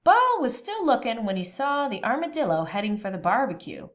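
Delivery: ordinary delivery, nothing marked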